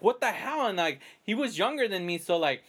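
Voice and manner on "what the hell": much higher, baffled outcry; the shock of hitting the floor; retold disbelief. Swept to the floor, retelling the baffled shout. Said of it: "I was like".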